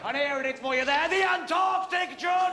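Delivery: hollered reveal, laughing; triumphant comic shouting. Real, not acted. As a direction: roar out the absurd title.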